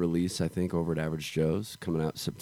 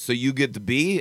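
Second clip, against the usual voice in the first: raised-pitch, louder imitation of a Latvian accent; hammed-up Latvian accent, pitched up; mock Latvian accent; 7 semitones higher, 6 dB louder than usual. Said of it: "that's the accent I use".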